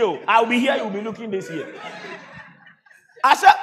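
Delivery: ordinary talk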